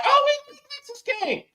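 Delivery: high put-on fan voice; mocking fans. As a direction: squeal it like a bandwagon fan. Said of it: "Now they're like".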